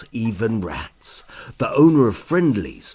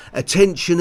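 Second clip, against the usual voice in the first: loud, higher call for attention; 7 semitones higher, 6 dB louder, faster than usual. Acted. Sparky calls the guests to attention. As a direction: call the crowd to listen.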